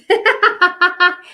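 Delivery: high delighted laugh; a burst of delighted laughter; bright laugh. Real, not acted. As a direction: laugh out loud, high and happy. Live reader laughing at comment.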